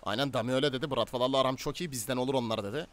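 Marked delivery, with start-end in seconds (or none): none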